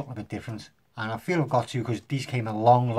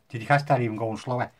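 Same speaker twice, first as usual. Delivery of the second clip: normal talking voice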